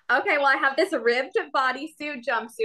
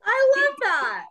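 high, excited gushing over an outfit; gushing red carpet chatter; 12 semitones higher, 4 dB louder, slower than usual. Real, not acted. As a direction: squeal it like a fan on the carpet.

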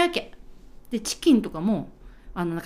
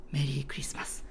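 soft closing line; 7 semitones lower, 12 dB quieter than usual. Acted. Ending line of radio drama.

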